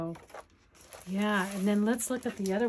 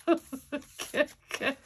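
giggling through the word, a little louder; laughing okay; 6 semitones higher, 4 dB louder than usual. Real, not acted. To viewers, after forgetting what she sought, laughing. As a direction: laugh it off, amused at herself.

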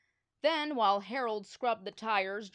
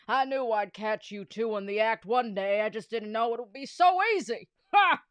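loud gloating villain with a final bark of a laugh; gloating principal; 8 dB louder than usual. Acted. Principal crows over the caught boys, loud gloating.

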